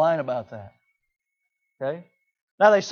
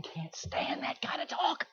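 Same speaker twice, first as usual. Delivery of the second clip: a hushed, unvoiced aside; confiding whisper to the parents; 9 semitones higher, 11 dB quieter, slower than usual. Real, not acted. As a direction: lean in and whisper it.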